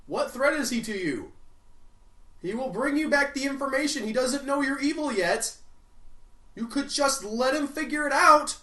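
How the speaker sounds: usual speaking voice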